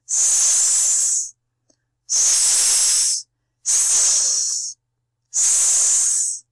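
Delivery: long, forceful "sss" to scare someone off; snake hiss. Acted. Letter C scaring away E and I, long snake hiss. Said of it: "comme s'il y avait un serpent".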